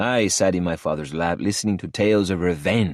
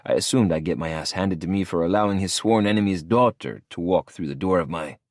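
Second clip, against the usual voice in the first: plain reading voice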